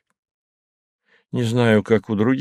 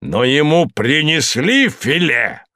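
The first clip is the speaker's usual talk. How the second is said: indignant; 6 semitones higher, 3 dB louder, slower than usual. A narrator's reading. Moses indignant question.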